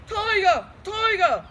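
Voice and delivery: loud, high cries of panic; panicked shouting. A narrator's reading. Big Bill sees the dragon and yells in panic. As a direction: yell the wrong animal in fright. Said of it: "and he shouted".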